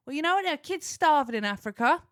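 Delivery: high and loud, hammed-up parent lecture; dad lecturing his son about starving kids, loud; mock parental shout. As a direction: bellow the old parent line. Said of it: "And I went".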